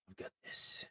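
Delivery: hushed, voiceless character whisper. Acted. Jonas pushes past the party, whispering. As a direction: whisper it to yourself, acting the part.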